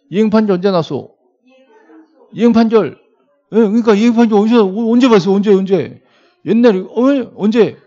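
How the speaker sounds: loud, high-pitched demand for an answer; insistent questioning. Real, not acted. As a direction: press the class urgently, raising your voice. Pressing students for an answer.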